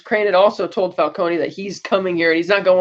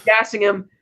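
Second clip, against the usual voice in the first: normal talking voice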